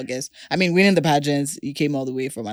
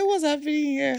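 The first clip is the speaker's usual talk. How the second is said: very high mock bewilderment; amused mimicry; 12 semitones higher than usual. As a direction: mimic her puzzled arrival in a high voice. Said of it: "She said".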